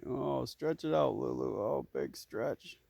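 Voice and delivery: very slow, stretched coo; drawn-out praise. Real, not acted. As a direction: stretch the words out like the yawn.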